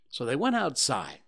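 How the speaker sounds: ordinary delivery, nothing marked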